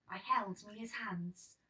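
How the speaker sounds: plain reading voice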